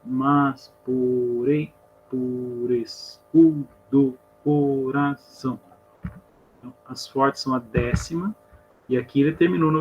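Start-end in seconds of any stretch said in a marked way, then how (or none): 0.0-5.6 very slow, POREI and CORAÇÃO split into syllables. Shown as a demonstration. Teacher counting poetic syllables for students, syllable by syllable. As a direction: stretch out each syllable of MAS POREI POR ESCUDO. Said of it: "sílaba poética não é igual à sílaba gramatical".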